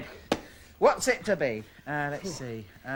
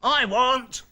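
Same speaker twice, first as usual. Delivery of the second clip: loud, high demand; raised, insistent story-character outburst; fuming; 8 semitones higher, 7 dB louder than usual. A narrator's reading. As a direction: demand it like an angry child. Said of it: "fumed Banana Bill".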